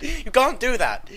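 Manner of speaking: mock outrage.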